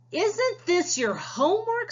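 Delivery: a playful surprised teacher. Acted. Miss Tickle asking Jojo about his homework, mock surprised.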